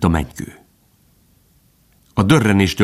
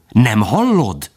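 "hallod" drawn out slowly with a rising swell, then falling; insistent repeat; 3 semitones higher, slower than usual. A narrator's reading. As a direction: stretch out "hallod" as if pressing the point. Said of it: "ismételte Bambi". (nyomatékosan, elnyújtva)

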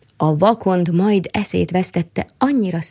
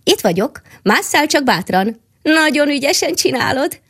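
a higher, cheering little squirrel voice; encouraging squirrel; 8 semitones higher than usual. Acted. Squirrel cheers on the climbing mole, higher and encouraging. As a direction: egg him on brightly as the squirrel.

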